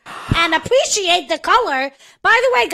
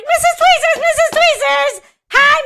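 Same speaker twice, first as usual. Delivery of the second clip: high, loud, drawn-out hailing; mock calling out; 13 semitones higher, 7 dB louder than usual. Retelling being hailed while grocery shopping, mock calling out. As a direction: hail someone across a shop in a high, exaggerated voice.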